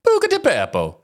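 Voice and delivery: high, hammy mock Italian; fake Italian accent. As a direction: say it like a cartoon Italian. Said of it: "the Family Guy fake Italian thing".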